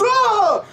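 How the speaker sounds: very high, loud "ah"; sudden attack cry. Acted. Animal paw shoots from the cage, sudden high cry. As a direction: burst out in a sudden high yell. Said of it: "shoots out and goes".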